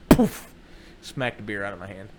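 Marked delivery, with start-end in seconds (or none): 0.1-0.5 loud, puffed impact noise; imitated smack. Wife smacks beer from his hand, imitated impact noise. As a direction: blow out a sudden loud "poof".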